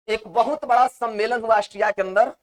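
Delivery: normal talking voice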